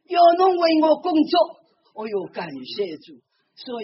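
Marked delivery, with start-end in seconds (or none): 0.1-1.3 high, loud commanding answer; voice of Jesus. Retold or imitated. Jesus's reply voiced in testimony. (高声有力地传达耶稣的话)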